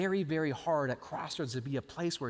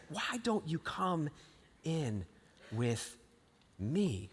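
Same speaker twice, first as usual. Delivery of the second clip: soft, slow welcome from God; tender invitation; 4 dB quieter, slower than usual. Acted. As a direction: gently coax them in, word by word.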